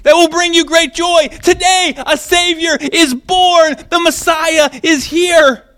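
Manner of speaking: lofty high angel proclamation. Retold or imitated. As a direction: proclaim it like a heavenly messenger. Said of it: "But he says".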